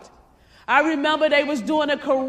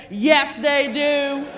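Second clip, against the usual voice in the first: insisting; 2 semitones higher, 6 dB louder, much slower than usual. Retold or imitated. Daughter insisting harder.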